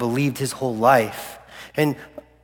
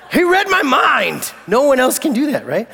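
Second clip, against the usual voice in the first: amazed Pharisee; 10 semitones higher, 4 dB louder than usual. Acted. Imagined amazed Pharisee reaction.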